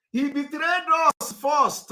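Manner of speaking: loud, high, slow and emphatic; angry accusation. Real, not acted. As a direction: hurl the accusation, stretching each word. Angry political rant, repeated accusation.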